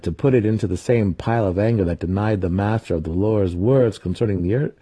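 plain reading voice

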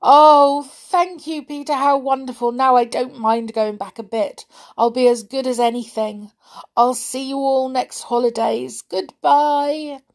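high, loud, delighted brownie; beaming. A narrator's reading. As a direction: burst with grateful joy. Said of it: "He beamed".

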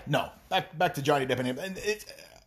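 ordinary talk

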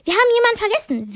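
quick, high and sharp. Acted. Ron suddenly remembers someone, sharp and quick. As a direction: snap it out urgently. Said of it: "Da sagt Ron scharf".

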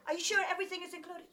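quick, pressing question; fast doubtful check. Retold or imitated. She presses the local man on the price, quick and doubtful. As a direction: push for a straight answer, fast.

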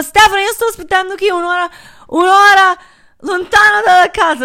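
high, loud, fast furious yell down the phone; angry yell. Retold or imitated. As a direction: let it burst out at him. Yelling at boyfriend on phone.

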